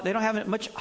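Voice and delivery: ordinary delivery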